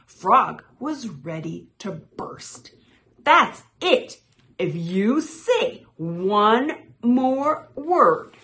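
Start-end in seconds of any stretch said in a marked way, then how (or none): 3.2-8.4 high, loud threat that slows down; exploding with anger. A narrator's reading. Frog at bursting point warning Toad, loud slow threat. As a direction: burst out, then space the threat slowly.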